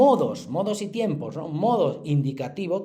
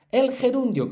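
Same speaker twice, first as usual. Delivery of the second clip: announcing heading; 10 semitones higher, 6 dB louder than usual. Shown as a demonstration. Teacher announces new topic heading.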